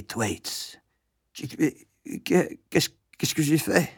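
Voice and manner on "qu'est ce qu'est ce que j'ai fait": higher, halting little schoolboy voice; frightened stammer. A narrator's reading. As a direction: stumble over the words, pleading innocence. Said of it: "bredouilla-t-il en implorant". (bredouillement implorant)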